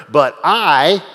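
"I" hit loud and drawn out; strong, loud stress landing on "I"; emphatic I. Shown as a demonstration. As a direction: punch the word "I" hard after "But". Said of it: "it's emphatic".

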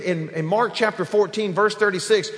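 ordinary talk, nothing marked

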